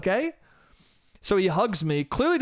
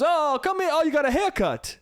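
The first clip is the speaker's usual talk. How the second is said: cheerful uncle; 10 semitones higher than usual. Retold or imitated. Uncle suddenly welcoming nephew, high and cheery. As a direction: greet him like a long-lost kid. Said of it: "And he goes".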